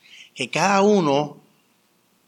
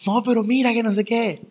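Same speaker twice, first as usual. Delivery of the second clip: worried sister; 8 semitones higher than usual. Retold or imitated.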